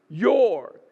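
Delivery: "Your" drawn out and punched; stress on Your. Shown as a demonstration. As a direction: hit "Your" hard, like an accusation. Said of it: "really stressed the word your".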